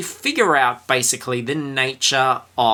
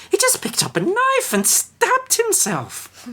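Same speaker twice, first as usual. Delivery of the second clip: very high, slow, frantic storekeeper; slower than usual. Retold or imitated. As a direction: squeal it out in a panicked falsetto. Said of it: "the storekeeper was explaining".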